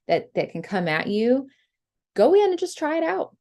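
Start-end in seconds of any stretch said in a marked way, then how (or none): 2.2-3.4 pep talk. Real, not acted. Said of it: "coaching listeners to dare".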